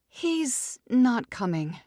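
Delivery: stunned friend delivering news; Emily, shaken. Acted. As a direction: drop it gently and slowly.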